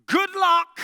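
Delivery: high, loud ironic cheer; mock good luck. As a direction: shout it like a hopeless wish. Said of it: "ironic repeat".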